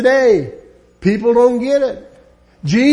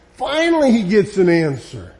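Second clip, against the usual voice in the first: ordinary delivery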